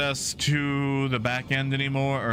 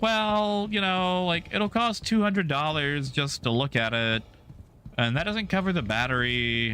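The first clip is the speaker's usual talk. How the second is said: put-on higher-pitched Valley Girl accent, easing down toward the end; 6 semitones higher than usual. Quoting the repair rep's price in a Valley Girl accent. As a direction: mimic the phone rep as a bubbly Valley Girl accent. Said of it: "I gave her a Valley Girl accent".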